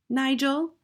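put-on high little-boy voice, rising; high, tentative schoolboy; small brave boy. A narrator's reading.

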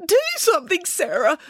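high, pleading bear voice; frightened urging. A narrator's reading. Boris begs his sister for help, frightened urging. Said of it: "urged Boris".

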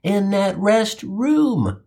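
normal talking voice

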